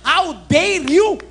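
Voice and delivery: loud, forceful scolding; indignant rebuke. Real, not acted. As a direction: challenge them with outrage.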